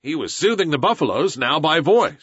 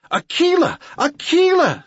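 high, loud happy calling of a name; joyful call; 4 dB louder than usual. A narrator's reading. Mowgli greets Akela rising from the hole, high joyful call. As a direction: call out the old friend's name with delight.

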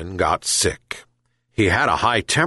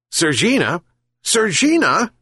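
high calls, rising higher on the second; shouted call; 10 semitones higher than usual. A narrator's reading. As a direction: call the cat insistently, pitched high. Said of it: "he shouted again".

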